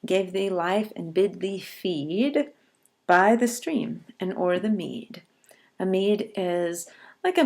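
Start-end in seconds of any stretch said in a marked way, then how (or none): 0.0-5.2 poem recitation. Shown as a demonstration. Teacher reciting the poem slowly.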